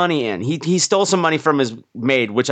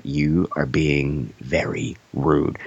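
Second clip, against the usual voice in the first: much lower, slow, very proper voice; stiff rebuke; 13 semitones lower, 3 dB quieter, much slower than usual. Retold or imitated. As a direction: put on a deep prim gentleman scolding slowly. H G Wells scolding, retold.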